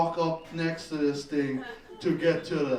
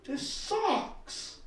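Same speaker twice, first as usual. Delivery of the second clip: mock outrage; 9 semitones higher, 5 dB quieter, much slower than usual. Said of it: "And I'm like".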